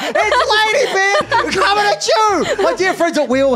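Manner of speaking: over-the-top show intro. Pretend. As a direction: draw every word out high to greet the viewers. Show-opening host greeting.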